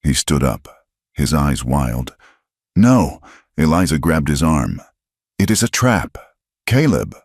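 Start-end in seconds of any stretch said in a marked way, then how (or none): 2.8-3.2 loud, higher cry; sharp no. Acted. Eliza grabbing Cal's arm, loud sharp no. 5.4-7.1 higher urgent warning; urgent plea. Acted. Eliza restraining Cal, urgent higher plea.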